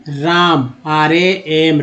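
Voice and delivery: usual speaking voice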